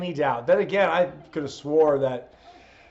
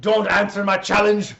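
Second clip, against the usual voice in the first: loud, raised Scottish wrestler promo; Drew McIntyre; 4 semitones higher, 5 dB louder than usual. Acted. Wrestler challenging his rival for WrestleMania, Drew McIntyre impression. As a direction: bellow a challenge in a Scottish accent. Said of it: "I do a pretty good Drew McIntyre".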